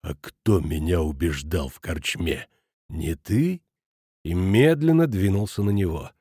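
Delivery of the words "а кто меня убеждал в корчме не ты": low, slow, menacing question; simmering anger. A narrator's reading. Schmidt accusing Futaki over the tavern talk, simmering. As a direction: seethe quietly, drawing it out. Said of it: "Шмидт тут побагровел".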